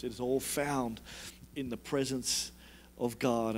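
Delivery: low, hushed sermon with long pauses; soft, earnest preaching. Real, not acted. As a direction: speak gently and low, letting each phrase settle.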